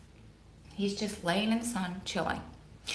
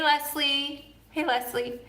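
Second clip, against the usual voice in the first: higher-pitched pet-owner greeting; talking-to-a-dog voice; 6 semitones higher, slower than usual. Real, not acted. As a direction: greet them lightly as if they were a dog. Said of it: "now I'm talking to you like you guys are the dog".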